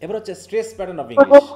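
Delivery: loud and slow, announcing the new topic; loud announcement. Real, not acted. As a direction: call out the heading to the class. (জোরে ধীরে ঘোষণা)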